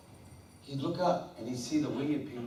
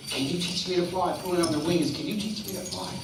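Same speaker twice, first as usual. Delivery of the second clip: higher, nagging plea; pestering turtle; 3 semitones higher than usual. Acted. Turtle nagging the swans, higher and insistent. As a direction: keep tugging and begging. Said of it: "he goes".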